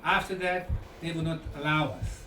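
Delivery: ordinary delivery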